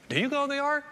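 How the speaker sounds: high-pitched imitation of a stranger's question; curious stranger. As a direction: voice an inquisitive acquaintance, pitched well up. Retold stranger questioning Joy in store.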